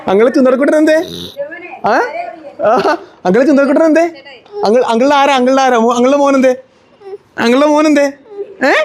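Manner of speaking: high, affectionate cooing questions. Real, not acted. Uncle asking his darling nephew who he is, doting baby talk.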